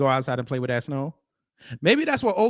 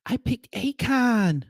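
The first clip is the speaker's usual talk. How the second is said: put-on slower, higher little-kid voice; imitating kid; 8 semitones higher, slower than usual. Mimicking the small kid, high and slow. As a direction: lightly mimic the toddler.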